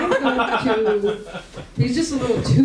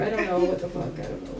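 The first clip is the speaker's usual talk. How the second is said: ordinary talk, nothing marked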